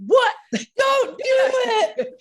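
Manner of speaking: high, drawn-out mock shout; retold yell. Retelling a panicked reaction to the child's question, mock yell. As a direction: yell it in alarm through laughter. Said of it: "you yelled, like".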